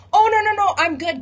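a near-yell of no thanks, I'm good; loud stubborn refusal. Retold or imitated. Man refuses the helicopter ladder.

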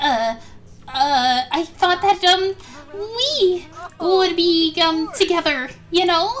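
high start, then halting stammer full of ums; nervous confession. Acted. Layla admitting feelings to Multi, nervously stammering. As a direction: stammer and stall before confessing love.

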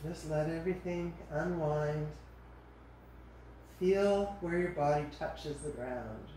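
soft, calm body-scan voice; guided meditation. Acted. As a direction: ease them into rest, unhurried and soft. Leads a relaxation body scan.

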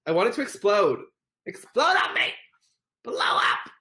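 high, shouted cheer; thrilled shouting; excited urging. Real, not acted. Cheering on a gift animation.

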